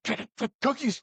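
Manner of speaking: high, put-on squeezed voice; possessed Santa. Mimicking Santa's takeover of Scott, high put-on voice.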